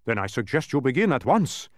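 mildly stern dodecahedron; admonishing. A narrator's reading. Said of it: "admonished the dodecahedron from his admonishing face".

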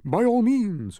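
high, loud, grand pompous voice; pompous humbug. A narrator's reading. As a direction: declaim it grandly.

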